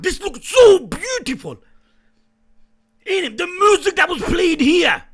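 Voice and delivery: loud amazed shouting; excited shout. Real, not acted. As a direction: shout at the screen in awe. Hyped reaction to scene.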